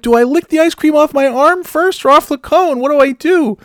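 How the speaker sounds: frantic, very high shouted questions; loud high-pitched retold freak-out; panicked yell. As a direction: shout helplessly in comic panic. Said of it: "I'm yelling at Kim".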